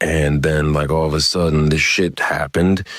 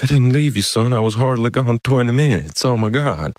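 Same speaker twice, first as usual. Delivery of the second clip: protesting dad; 8 semitones higher than usual. Retold or imitated. Dad defends himself to upset son.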